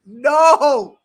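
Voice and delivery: loud anguished no; dismayed shout. Real, not acted. Streamer seeing viewer's player hurt, loud dismayed no.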